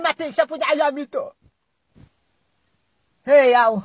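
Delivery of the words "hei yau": loud call across the yard; loud hail to Yau. Acted. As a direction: shout his name to get attention. Calling out to Yau.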